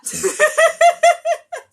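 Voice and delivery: high loud embarrassed laugh. Real, not acted. Laughing after belting for viewers. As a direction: burst out laughing. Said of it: "embarrassed after singing".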